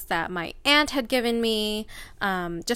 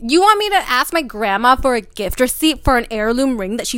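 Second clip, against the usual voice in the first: bursting out louder and higher at first; indignant, hitting They're gifts hard; real outrage; 3 semitones higher, 7 dB louder than usual. Real, not acted. Telling her friend about her dad, real outraged outburst.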